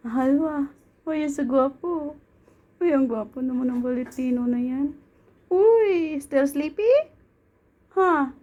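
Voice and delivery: high, sing-song coo at the cat. Real, not acted. Owner baby-talking to her cat.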